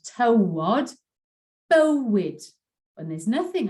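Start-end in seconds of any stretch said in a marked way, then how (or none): 0.0-2.4 tywod and bywyd with the yw as a rounded O; O sound in yw. Shown as a demonstration. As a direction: Welsh yw said like O in tywod. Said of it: "it's an O sound".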